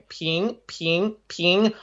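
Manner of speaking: "ping" rising again and again; repeating the tone. Retold or imitated. Student in the teacher's office drilling "ping". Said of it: "over and over again".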